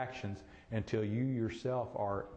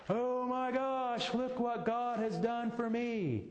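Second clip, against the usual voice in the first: joyful amazement; 11 semitones higher than usual. Retold or imitated. Churchgoer's joyful testimony.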